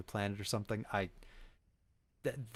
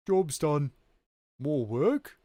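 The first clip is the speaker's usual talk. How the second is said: louder, higher cheery peasant voice; Warcraft peasant builder; 4 semitones higher, 7 dB louder than usual. Retold or imitated. Streamer answering a request as a castle builder. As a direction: chirp it like a game worker unit. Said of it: "imitate a famous medieval castle builder".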